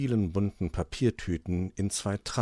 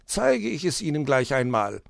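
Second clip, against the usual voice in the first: higher fox voice, eager and proud; proud fox explaining; 12 semitones higher, 5 dB louder than usual. Acted. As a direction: show off the new switch. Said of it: "erklärte er".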